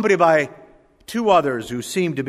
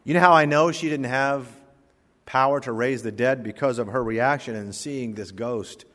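ordinary talk, nothing marked

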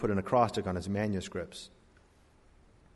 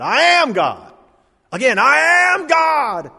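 a much higher, louder, slow scream; 14 semitones higher, 11 dB louder, slower than usual. Retold or imitated. Shirley MacLaine on the beach, screaming with conviction. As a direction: belt it out as if possessed. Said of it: "She began to scream it".

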